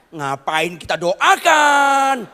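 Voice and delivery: loud, slow, high rebuke; indignant shout. Real, not acted. Preacher rebuking parents who want prayer instead of study, shouted slowly. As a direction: hammer home the outrage.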